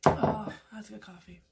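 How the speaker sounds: ordinary talk, nothing marked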